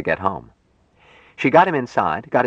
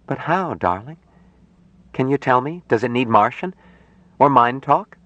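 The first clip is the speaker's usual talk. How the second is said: higher-pitched, questioning woman's voice; puzzled Jill; 6 semitones higher than usual. Acted. Jill asks Mike how he groks people, puzzled. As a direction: gently probe with rising questions.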